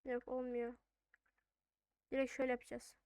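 normal talking voice

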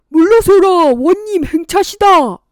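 loud, high commanding cry; herald's shout. Acted. Herald clearing the road for the magistrate, loud commanding shout.

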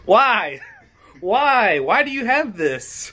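high, loud incredulous why; shocked disbelief. Real, not acted. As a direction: demand it in disbelief. Shocked at find.

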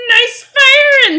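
loud, high, drawn-out pirate cheer. Acted. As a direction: roar your approval as a pirate. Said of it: "doing this pirate voice".

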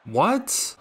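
sudden loud disbelieving yelp; shocked shout. Real, not acted. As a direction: blurt it out in total shock. Shocked at his keeper's blunder.

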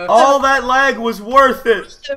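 loud, drawn-out triumphant cheer; excited. Real, not acted. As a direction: savour the win, big and slow. Cheering on reaching space.

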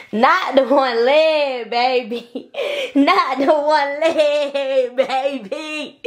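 slow, high playful tease; teasing. Real, not acted. Mom teasing baby's crawl.

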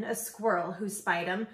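plain reading voice

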